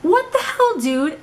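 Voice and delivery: high, startled what the hell; a retold jolt of shock at the stranger; imitating husband's startled high cry; retold shocked outburst. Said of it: "Boo's like".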